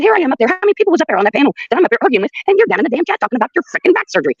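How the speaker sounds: high-pitched accusing complaint; worked-up scolding, retold without shouting; retold indignant rant. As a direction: pile up the grievance, pitch riding high. Retold angry confrontation of her friend.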